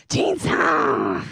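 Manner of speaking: loud, low, drawn-out name call; hearty exclamation. Retold or imitated. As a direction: imitate a colleague booming out a greeting. Colleague's delighted reply breaks the ice.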